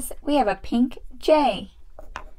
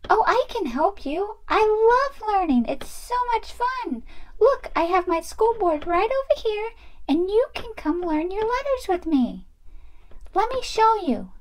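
high, sing-song doll voice; cheerful learner doll; 9 semitones higher than usual. Pretend. Doll loves learning then spots crying friend, high and eager. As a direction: play an eager little doll, pitched way up. Voices Peach offering help.

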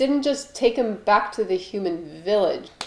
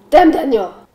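louder mock exclamation; meme shout; 5 semitones higher, 9 dB louder than usual. Quoting a meme to the camera, loud mock shout. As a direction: belt out the meme line.

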